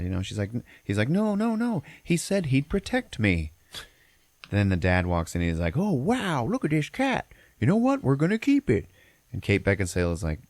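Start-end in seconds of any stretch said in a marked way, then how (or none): 1.1-3.5 put-on high, drawn-out child's plea; pleading little boy. Son begs mom to keep the stray cat, high mock pleading. 5.8-8.9 high put-on dad voice, full of mock wonder; delighted goofy dad. Dad walks in and sees the cat, hammed-up delight.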